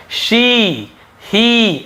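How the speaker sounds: "शी, ही" with a long "ee"; correct long E. Shown as a demonstration. Teacher correcting the misreading, "शी, ही" with long "ee". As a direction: stretch the "ee" in "शी, ही". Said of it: "ऐसे है".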